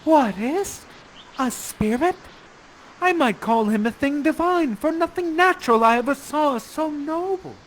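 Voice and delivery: high-pitched awestruck stage declamation; Miranda crying out. Acted. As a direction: cry it out in wonder, pitch lifted. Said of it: "And you cry out".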